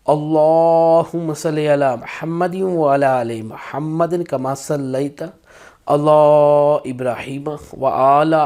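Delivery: teacher modelling the right way to recite; correct recitation. Shown as a demonstration. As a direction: draw out each letter of the durood. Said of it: "ہم کس طرح پڑھ سکتے ہیں".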